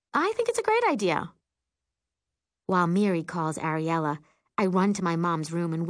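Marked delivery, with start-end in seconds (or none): 0.1-1.3 high, quick, over-eager casualness; struggling nonchalance. A narrator's reading. Sister encouraging a phone call, struggling to sound nonchalant. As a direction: rush it out high while playing it cool. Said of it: "struggling to keep my voice nonchalant".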